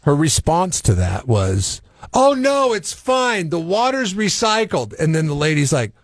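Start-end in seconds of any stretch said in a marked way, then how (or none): 2.1-4.9 much higher, light airy brush-off; breezy dismissive woman. Retold or imitated. Retelling the car-washing woman brushing off the neighbor, high breezy mock voice. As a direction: toss it off sweetly, unbothered.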